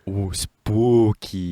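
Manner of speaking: spooky voice. Pretend. Mock Halloween spooky voice.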